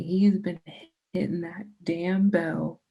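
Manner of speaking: ordinary talk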